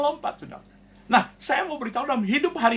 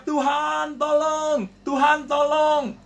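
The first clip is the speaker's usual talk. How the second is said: high, drawn-out mock yell; imitated shout to God, pitched up; retold cry for help; 5 semitones higher, much slower than usual. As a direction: call out for rescue, stretching the vowels. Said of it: "Dia teriak".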